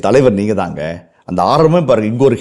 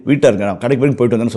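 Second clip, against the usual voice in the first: quick chatty reply; faster than usual. Retold or imitated. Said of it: "சொல்லுவான்".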